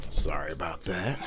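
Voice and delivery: quick hushed apology off to the side; whispered sorry; whispered aside. Real, not acted.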